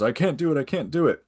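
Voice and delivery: high, pleading, mock terror; panicked cowering. Gawain fleeing the knight, high mock panic. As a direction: whimper it like a coward fleeing. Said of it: "Gawain cowards and ducks and runs and says".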